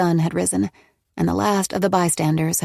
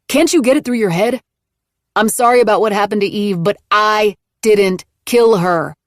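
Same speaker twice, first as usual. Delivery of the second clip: exasperated anger; 4 semitones higher, 6 dB louder than usual. Acted. Nick angrily denying to Nola.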